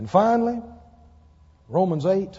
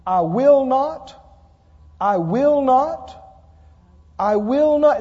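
slow, louder, each I will not drawn out; preached emphasis; 3 semitones higher, 5 dB louder, slower than usual. Acted. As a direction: press every I will not like a vow. Amplified promise, repeated three times.

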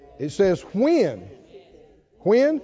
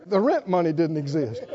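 ordinary talk

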